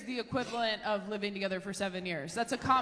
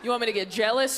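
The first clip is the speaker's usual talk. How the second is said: ordinary talk, nothing marked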